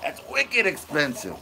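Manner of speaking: put-on Boston accent. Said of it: "I can't do Boston".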